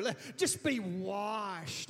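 very slow, drawn-out pious delivery; exaggerated slow church voice. Shown as a demonstration. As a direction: stretch "washed" out with mock holiness. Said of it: "slower and you sound a little more spiritual".

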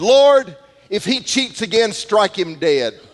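loud, high call for God to strike him; mock angry prayer. Teen at card game calling down wrath, mock angry shout.

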